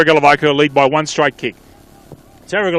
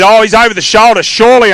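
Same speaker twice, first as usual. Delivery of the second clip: higher, louder burst of commentary; excited call; 5 semitones higher, 4 dB louder than usual. Real, not acted. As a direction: react to the missed free, pitch up. Commentator reacts to a missed free kick.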